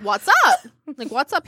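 high, breezy what's up; fake-casual greeting while walking into his house. Walking in to confront him.

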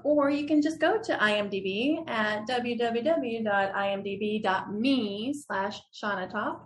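ordinary talk, nothing marked

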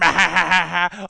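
booming cartoon villain cackle; evil villain laugh. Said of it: "villain in a Transformers cartoon".

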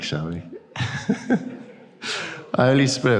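ordinary talk

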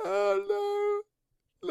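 high wailing mock sob; hammed-up high-pitched crying. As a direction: wail the numbers in a put-on cry. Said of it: "This is me really crying".

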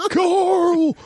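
high, mock American drawl breaking into laughter; bad American accent. Joining in mocking The Walking Dead actor, bad American accent. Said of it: "Terrible American accent".

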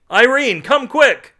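high, loud holler; excited, high-pitched shout; urgent calling out. A narrator's reading. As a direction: yell for someone to hurry over. Carl calls wife urgently.

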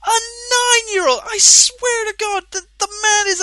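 loud, high-pitched exasperated shouting; outraged shout, voice climbing; angry rant. Real, not acted. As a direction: yell it out in real disbelief. Ranting at parent's game purchase.

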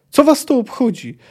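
high angry shout. Acted. Ciri shouting at the band, furious. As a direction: fling the question at them. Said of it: "krzyknęła Ciri".

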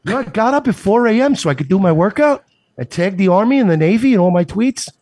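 put-on a concerned neighbor in a Boston accent; concerned Mark Wahlberg. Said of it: "impression of a concerned citizen Mark Wahlberg".

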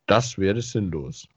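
ordinary talk, nothing marked

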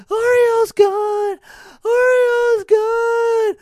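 high, drawn-out mock wail; hammed-up panicked scream, stretched long; imitating freak-out. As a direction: mimic a frantic shriek, very high and loud. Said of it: "She's freaking out".

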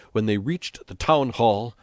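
ordinary delivery, nothing marked

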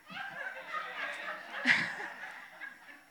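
a burst of high breathy laughter; real laughter. Real, not acted. Laughs at her own joke.

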